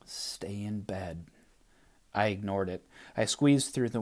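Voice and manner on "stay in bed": slow, eerie whisper; whispering wind. Acted. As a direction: breathe a warning like the wind. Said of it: "the wind issued a warning".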